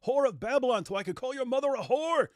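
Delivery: high, loud mocking rant; mocking Sam's rant. Host mocks the preacher justifying insults with Scripture, high mocking rant. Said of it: "And so he'll say".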